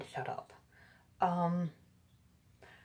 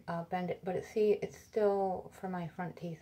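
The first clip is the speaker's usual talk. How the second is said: ordinary talk, nothing marked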